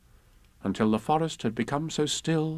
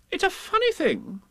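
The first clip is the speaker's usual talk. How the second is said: very high character voice; puzzled Rabbit. A narrator's reading. Rabbit lost in the mist, high and puzzled.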